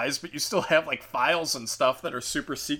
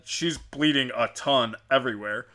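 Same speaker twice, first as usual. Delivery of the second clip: usual speaking voice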